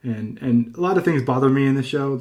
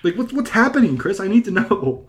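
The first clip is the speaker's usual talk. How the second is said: high, bewildered demand; begging for an answer; exasperated plea; 7 semitones higher than usual. Real, not acted. Host pleading with Chris to explain, high and bewildered.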